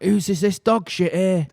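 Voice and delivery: high-pitched, drawn-out call; hammed-up high holler at no great volume; mock neighbour's call. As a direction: call out like an indignant neighbour, imitated. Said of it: "dead loud. One of the neighbours like".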